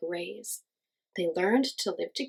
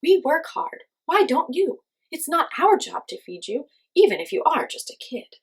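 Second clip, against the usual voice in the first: loud, higher scolding; scornful grown-ups, raised voices; scolding crowd; 7 semitones higher, 6 dB louder than usual. A narrator's reading. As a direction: lecture him like a lazy child. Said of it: "they said".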